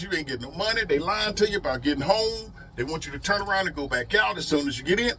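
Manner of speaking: ordinary talk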